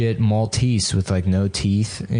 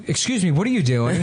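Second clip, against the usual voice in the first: higher, mock-polite employee; 8 semitones higher than usual. Store employee scolding the boys, mock polite. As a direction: imitate a staffer scolding. Voicing petstore staff confronting them.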